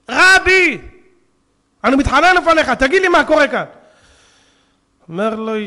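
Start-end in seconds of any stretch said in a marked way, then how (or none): 0.1-3.6 loud, high mock-desperate plea; tearful begging. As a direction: cry out to the rabbi, begging for an answer. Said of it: "ובוכה". (תחינה זועקת ובוכייה)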